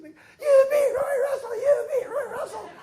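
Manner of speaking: very high, very loud and slow, laughing; ecstatic shout. Retold or imitated. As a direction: yell it over the crowd in joy. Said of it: "Billy's like".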